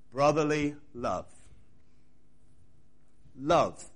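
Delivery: Love set apart alone, leaned on; stress on love. Shown as a demonstration. Preacher asks hearers to stress the word love. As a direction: give the word Love its own weight. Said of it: "I want you to stress that word".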